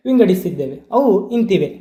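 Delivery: usual speaking voice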